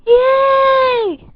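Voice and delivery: high squealed "Yay!"; happy cheer. Real, not acted. Thanking viewers, a high happy squeal. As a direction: cheer that they're watching.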